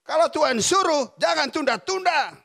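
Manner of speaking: loud, high call from the wife; wife's shout. Retold or imitated. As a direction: call it out like she's yelling across the room. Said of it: "teriak loh". (istri berteriak keras dan tinggi)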